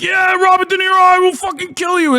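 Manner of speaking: high-pitched mock rage at Robert De Niro; mock furious fan. Mocking angry Trump fans threatening Robert De Niro, in a high mock-furious squeal. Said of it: "And they're like".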